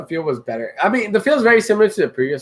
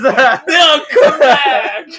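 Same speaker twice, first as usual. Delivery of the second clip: laughing; 9 semitones higher, 5 dB louder, much slower than usual. Real, not acted. Laughing through the line.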